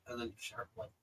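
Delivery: ordinary talk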